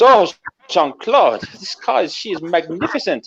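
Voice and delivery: put-on high French-accented gushing, stretched-out name; admiring Frenchwoman. Woman admiring the pilot's car, swooning. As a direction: gush lightly in a high French voice. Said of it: "And she goes".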